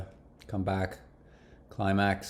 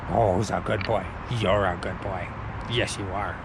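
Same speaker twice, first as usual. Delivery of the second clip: cooing dog praise, a bit lower; praising a dog; 4 semitones lower than usual. Real, not acted.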